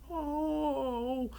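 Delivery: long high wail of terror; frightened cry. A narrator's reading. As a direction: cry out in a small, high child's voice. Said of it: "her voice cried".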